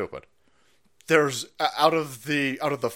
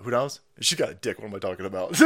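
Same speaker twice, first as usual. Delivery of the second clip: normal talking voice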